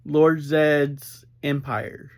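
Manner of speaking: ordinary talk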